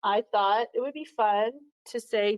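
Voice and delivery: normal talking voice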